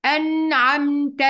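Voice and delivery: أنعمت slow, noon clear before ع; careful slow أنعمت; clear izhar. Shown as a demonstration. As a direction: recite أنعمت slowly with a sounded noon. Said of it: "يلا بينا ناخد مثال كمان".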